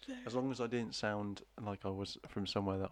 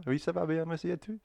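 high, lilting mock French; French accent; 8 semitones higher, 3 dB louder than usual. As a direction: answer in an overdone French lilt. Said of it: "we can do it at French accent".

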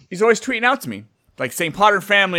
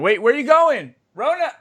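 calling out; 10 semitones higher than usual. Real, not acted. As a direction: shout after her as she leaves. Calls after departing Rona.

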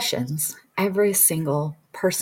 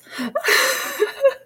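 high-pitched laugh. Real, not acted. Laughs at own wedding dress remark.

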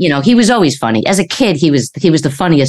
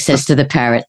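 ordinary talk, nothing marked

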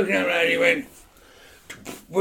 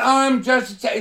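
panicky taxi driver; 7 semitones higher than usual. Retold or imitated. Cab driver jumping out at the police, higher panicky plea. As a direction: plead like a scared cabbie. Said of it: "he's going".

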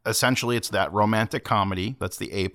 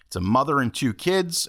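usual speaking voice

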